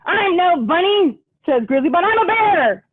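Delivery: high, proud mock-fierce bunny; Grizzly Bun. Grizzly Bun insisting to Bunny Bear, high and proud. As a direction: puff up and boast.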